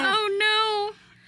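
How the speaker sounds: put-on high old man squeak.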